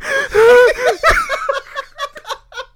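loud, high wheezing gasps; comic heaving for air; imitated gasping. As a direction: act badly winded, sucking in air. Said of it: "that was so fucking out of breath".

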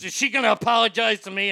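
indignant retort. Retold or imitated. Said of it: "the Spawn goes".

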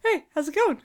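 high squeaky greeting; second voice. Shown as a demonstration. Asked for a second voice, squeaky high greeting. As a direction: pitch it way up like a cartoon. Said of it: "What's your second voice?".

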